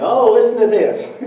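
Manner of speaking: high, loud, excited showing off; proud twelve-year-old bursting to share; eager kid. Retold or imitated. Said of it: "and I would go".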